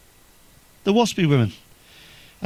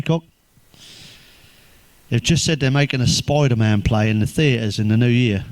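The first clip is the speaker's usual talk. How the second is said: usual speaking voice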